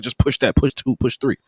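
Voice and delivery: mildly imitating a pushy official; a touch of a bossy customs officer; aggressive officer. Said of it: "The dude was real aggressive".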